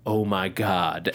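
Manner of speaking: a lightly voiced shocked Ichabod; imitating Ichabod. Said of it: "Ichabod going".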